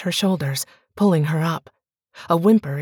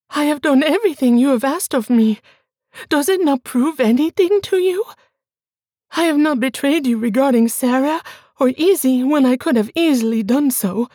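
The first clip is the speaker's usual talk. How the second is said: higher, louder and fast; urgent protesting wife; 8 semitones higher, 4 dB louder, faster than usual. Acted. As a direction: press her case in a rush. Bianca protests.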